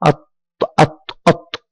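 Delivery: الط- broken off on the emphatic t; silent lam. Shown as a demonstration. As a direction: repeat الط- as "att", with no L. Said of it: "انتقلنا من الألف إلى الطاء". (نطق الط- بطاء مشددة دون لام)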